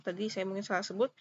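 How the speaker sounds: ordinary talk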